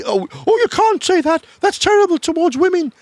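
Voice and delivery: high, loud mock outrage; hammed-up shocked voice; mock outraged objector. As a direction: squeal it like an offended prude. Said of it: "'cause that's like".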